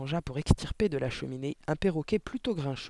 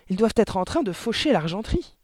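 raised, urgent voice; insistent; 6 semitones higher, 5 dB louder than usual. A narrator's reading. Mother worried for the silverware, urgent insistence. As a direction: keep pressing him. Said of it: "insista la mère".

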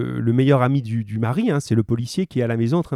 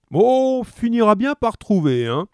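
high, questioning cop at the table; thick-voiced policeman; 7 semitones higher than usual. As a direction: speak up high through food. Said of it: "de leurs grosses voix pâteuses".

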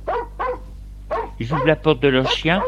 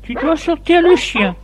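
high-pitched wife voice; storyteller's high, insistent wife; wife's order; 13 semitones higher than usual. A narrator's reading. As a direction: tell your husband what to do, pitched well up. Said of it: "Denise lui dit".

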